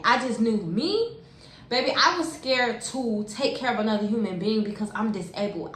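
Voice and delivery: normal talking voice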